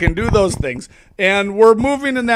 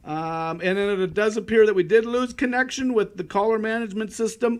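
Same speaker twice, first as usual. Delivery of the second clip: ordinary talk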